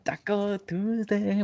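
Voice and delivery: high, drawn-out jokey call; silly high voice. Pretend. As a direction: sing out Taco Tuesday high and slow. Joking catchphrase voice.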